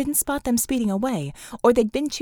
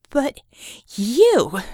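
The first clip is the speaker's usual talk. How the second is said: sharp accusing jab, louder; 2 semitones higher, 7 dB louder than usual. Acted. Vicky singling out Bull, accusing. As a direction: point the finger with your voice.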